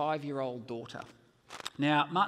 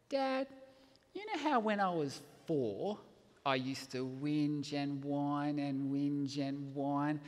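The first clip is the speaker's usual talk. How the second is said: put-on five-year-old daughter; 2 semitones lower, slower than usual. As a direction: tell it slowly like a little kid. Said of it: "my five-year-old daughter looks at me".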